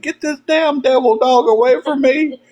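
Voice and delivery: high, put-on voice begging to be saved from the dog; mock panicked holler. Derek chased around the car by a dog, high scared holler. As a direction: squeal it like a grown man scared of a puppy. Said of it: "he's hollering. He's like".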